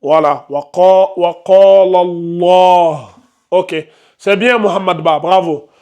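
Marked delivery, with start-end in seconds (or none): 0.5-3.1 drawn-out Arabic recitation; slow recitation. Shown as a demonstration. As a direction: recite it slowly, stretching each syllable. Teacher demonstrates Arabic reading.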